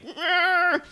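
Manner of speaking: very high, feeble roar; wounded tiger roar. Acted. Tiger bleeding out on the ground, high pained roar. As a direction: roar as a squealing, hurt animal. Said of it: "tiger's already bleeding out".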